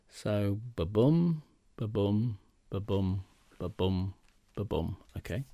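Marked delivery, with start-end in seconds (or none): 0.8-5.0 ba-boom chanted, weak then strong beat, fading softer each time; iambic beat. Shown as a demonstration. Teacher demonstrating iambic pentameter to interviewer, chanted beat. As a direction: tap out ba-boom like a heartbeat, dying away. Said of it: "That's the rhythm of iambic".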